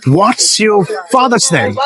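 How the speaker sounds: very slow, careful English question; slow model question. Shown as a demonstration. Teacher modelling the question for her pupil, very slow. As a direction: lay each word down for the learner. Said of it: "তার ইংরেজি হচ্ছে".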